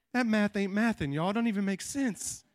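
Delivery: ordinary delivery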